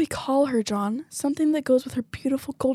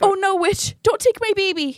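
desperate pleading; 7 semitones higher than usual. Acted. Mother begs for baby.

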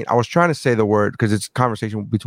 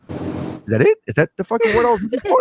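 surprised realization; 9 semitones higher than usual. Retold or imitated. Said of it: "And I was like".

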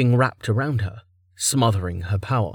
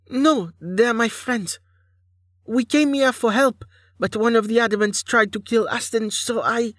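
high, pleading captive; anxious, high-pitched explanation; frightened Anya, protesting; 13 semitones higher than usual. Acted. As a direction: protest desperately in a small high voice.

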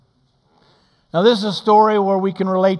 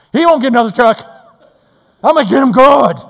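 loud, high hammed-up grudge; angry driver swearing to get him; mock angry vow; 7 semitones higher, 10 dB louder, faster than usual. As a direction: spit out the revenge plan. Angry man vowing revenge.

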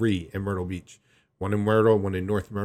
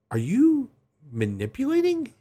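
disbelieving question; 13 semitones higher than usual. Retold or imitated. Suspicious question to mom.

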